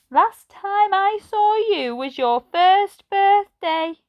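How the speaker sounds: put-on high, slow kindly old lady. A narrator's reading. Narrator voices old Edna greeting Ben, high and slow. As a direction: voice her like a gentle elderly lady. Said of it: "she said".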